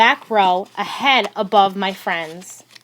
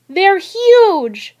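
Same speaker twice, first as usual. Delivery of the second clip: amazed exclamation; 9 semitones higher, 5 dB louder than usual. Acted. Brother marvels at Lincoln's shoes.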